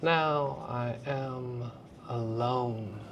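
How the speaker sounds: slow and hushed, stretching the vowels of "Now I am alone"; lonely, slow. Shown as a demonstration. Teacher showing Hamlet alone on stage, hushed and slow. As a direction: let "Now I am alone" hang, soft and lonely. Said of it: "it opens up those beautiful vowel sounds in a very lonely quality".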